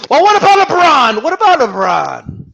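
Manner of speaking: high, loud mocking fanboy whine; hammed-up fanboy retort. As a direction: whine it like a sore fan.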